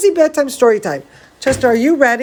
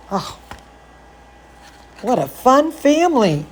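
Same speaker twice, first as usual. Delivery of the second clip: ordinary delivery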